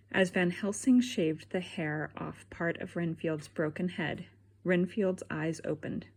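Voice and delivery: usual speaking voice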